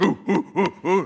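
playful gorilla hoots; mock chest-thumping hoots. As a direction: hoot like a gorilla beating its chest. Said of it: "like you're thumping your chest".

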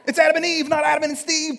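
loud, high, hammed-up retort; mock combative shout. Preacher mocking combative believers, high shouted slogan. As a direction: fire the slogan off loudly, pitched high.

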